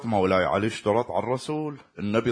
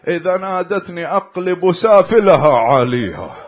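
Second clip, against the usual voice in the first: raised, louder vow of vengeance; forceful vow; 8 semitones higher, 11 dB louder than usual. Acted. Ali tells the Prophet his condition, forceful vow. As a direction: swear it loudly, pitch lifted.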